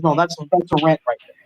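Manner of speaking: usual speaking voice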